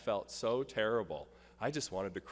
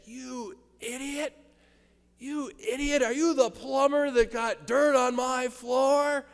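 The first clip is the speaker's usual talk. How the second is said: high, loud, slow retold yell of rage; furious yell; 11 dB louder than usual. Flooring guy yelling at the plumber, loud and furious. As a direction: bellow it like an angry drill sergeant. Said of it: "he yelled at me".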